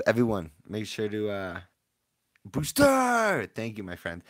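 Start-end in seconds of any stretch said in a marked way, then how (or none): 2.4-3.5 high, loud drawn-out shout; loud high-pitched hype call; hype yell. Real, not acted. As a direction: holler it out to the room. Hype yell among train whistles.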